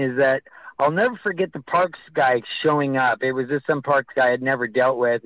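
usual speaking voice

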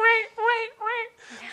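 high panicked cry. Retold or imitated. Realizing it's the same creepy man, panicked high cry. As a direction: squeal wait in mock terror.

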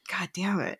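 hushed, whispered curse; whispered exasperation. Acted. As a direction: curse under your breath in mock dismay. Acted reaction to douchebag Darius.

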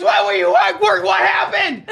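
high, urgent shouted questions; frantic yell. Retold or imitated. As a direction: yell it like a scared kid. Son shouting panicked questions.

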